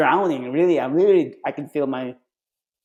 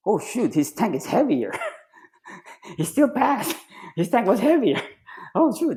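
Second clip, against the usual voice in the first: mock surprised instructors; 7 semitones higher than usual. Instructors mocked over heavy tank.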